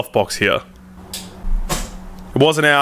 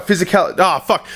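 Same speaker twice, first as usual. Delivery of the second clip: normal talking voice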